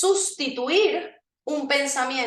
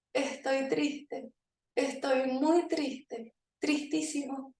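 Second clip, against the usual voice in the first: mock sad; 7 dB quieter, slower than usual. Shown as a demonstration. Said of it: "Y van a decir".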